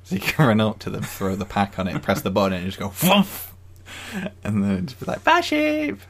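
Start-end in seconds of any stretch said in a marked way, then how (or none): 2.9-3.3 thump sound effect. Retold or imitated. Imitating launch sound for sheep. 5.2-6.0 very high, loud little voice; high squeaky farewell. Retold or imitated. Waving off a launched sheep.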